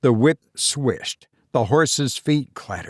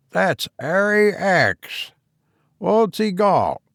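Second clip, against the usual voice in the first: put-on slow, high old London loafer; old cockney man; 7 semitones higher, slower than usual. A narrator's reading. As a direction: drawl it like an old cabman.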